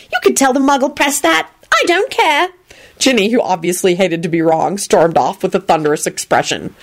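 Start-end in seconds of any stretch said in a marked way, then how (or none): 0.1-2.5 high-pitched carefree little professor; happy. A narrator's reading. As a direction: toss it off happily. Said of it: "Flitwick pronounced happily".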